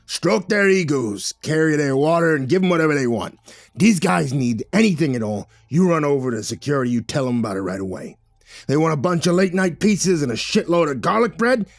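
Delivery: loud, emphatic boss laying down orders; bossy, big-hearted bluster, punching each order; gruff film boss Victor. Acted. Boss stressing that the film crew be kept happy, loud and emphatic.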